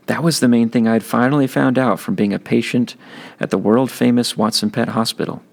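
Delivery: ordinary delivery, nothing marked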